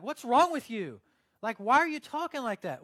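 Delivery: loud, high mock exasperation; exasperated challenge. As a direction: call out a friend's weird way of talking. Challenges friend about praying accent.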